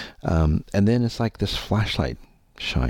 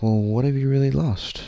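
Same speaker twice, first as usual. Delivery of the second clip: beautiful voice; 9 semitones higher than usual. Retold or imitated. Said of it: "beautiful voice, by the way".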